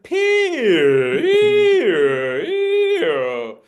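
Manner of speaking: climbing up high and loud across the words; pitch rising demo. Shown as a demonstration.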